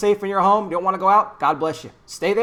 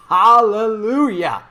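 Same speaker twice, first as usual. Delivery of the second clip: louder, slow exultant hallelujah; joyful hallelujah; 3 semitones higher, 7 dB louder, much slower than usual. Real, not acted. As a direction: cheer it out, drawn out. Cheering the mayor's decision.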